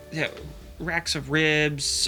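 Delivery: ordinary talk, nothing marked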